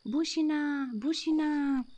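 high, loud frantic warning; alarmed call. Acted. Kitten yelling a warning to the dog, high and loud. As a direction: raise the alarm, high-pitched.

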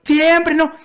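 put-on an old woman refusing at the top of her voice; grandma yelling. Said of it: "mi abuelita está gritando". (la abuela gritando, mucho más aguda)

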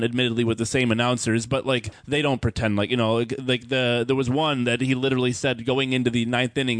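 ordinary talk, nothing marked

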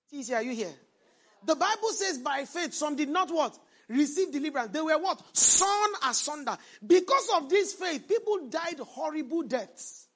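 rising, emphatic sermon delivery with loud peaks; full-force preaching. Acted. Preacher urging his congregation, loud emphatic preaching. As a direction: preach it out to the church.

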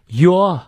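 "Your" without any final R, pitched high; British, silent R. Shown as a demonstration. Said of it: "这里啊，R不念".

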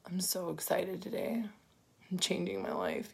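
bored monotone mockery of fake excitement; mock unexcited person. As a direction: parody a joyless "excited" post. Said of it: "this is their picture".

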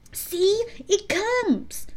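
high-pitched call; excited cry. A narrator's reading. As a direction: point it out to the others, high and excited. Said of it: "cried Curly".

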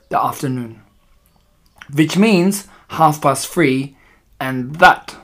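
put-on imperious witch with a foreign accent; accented villainess giving instructions. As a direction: lecture your followers in a thick accent, drawing out key words.

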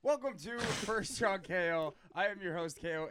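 loud, laughing hype intro; wahoo yell. Real, not acted. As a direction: yell the show open like an announcer. Host opens the podcast loudly.

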